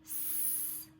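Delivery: whispered hissing S, no voice; isolated s sound. Shown as a demonstration. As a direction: hiss the S sound softly. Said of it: "Echo Miss Dalton".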